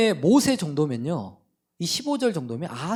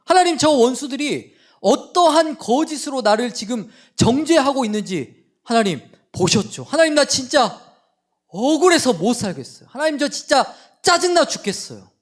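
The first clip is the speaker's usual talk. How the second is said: loud, higher, fuming prayer of grievance; huffing complaint; 7 semitones higher, 7 dB louder than usual. Retold or imitated. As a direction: huff and shout your grievance at God. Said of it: "씩씩거리면서".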